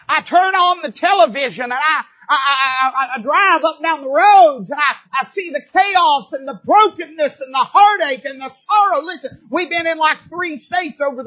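preaching fire. Real, not acted. Preacher crying out to the congregation about a broken world. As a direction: raise the voice to the rafters. Preacher builds to full cry.

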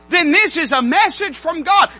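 loud. Acted. Loud preached repeat.

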